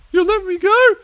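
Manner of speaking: high, loud squeaky question; hopeful suspect. Acted. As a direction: squeal it in surprised hope. Acting suspect in interrogation.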